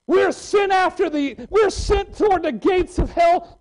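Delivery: high, ringing shout; preaching shout. Real, not acted. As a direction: shout it out to the congregation.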